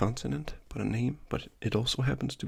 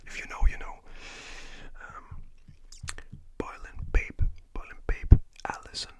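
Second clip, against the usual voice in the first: ASMR whisper. Acted. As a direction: whisper good night gently, like ASMR.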